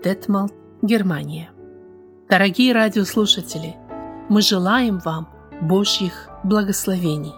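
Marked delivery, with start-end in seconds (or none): none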